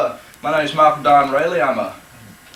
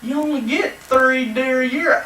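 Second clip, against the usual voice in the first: higher mock viewer voice; imitating the viewers; 8 semitones higher than usual. Host voicing viewers who object, mocking. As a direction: play the nagging viewer, higher. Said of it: "I know you're saying".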